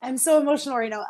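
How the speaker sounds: choked up but laughing it off; getting emotional. Real, not acted. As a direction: apologize through a laugh as emotion rises. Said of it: "I'm getting emotional".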